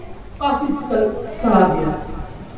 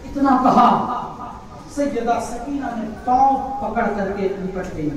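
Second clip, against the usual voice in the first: ordinary talk, nothing marked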